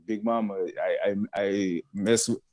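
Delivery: ordinary delivery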